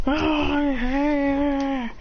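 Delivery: long drawn-out growling cry; bear attack cry. Acted. Bear grabbing onto him, drawn-out cry. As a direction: draw out an acted monster cry.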